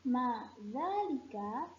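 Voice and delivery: Ma dzalika said slowly with a rising end; teacher's slow rising Ma dzalika; slow model phrase. Shown as a demonstration. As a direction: drag out Ma dzalika for the class to copy. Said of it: "Coba sekarang ikuti ustazah".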